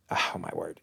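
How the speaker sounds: breathy exasperated whisper under the breath; whispered oh my word. Real, not acted. Reviewer whispering in disbelief at Magneto's speech. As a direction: sigh the words out in disbelief.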